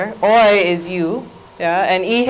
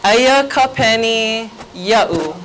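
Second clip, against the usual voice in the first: slow, drawn-out "Aya ka penny ya'u"; slow practice model; slower than usual. Shown as a demonstration. Teacher having the class practice the changed sentence, slowly modelled. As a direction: lead the phrase slowly for repetition. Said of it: "let's try practice that".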